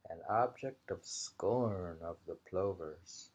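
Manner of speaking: measured, deliberate poem reading; haiku reading. Acted. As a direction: savor each image of the haiku. Reads haiku aloud.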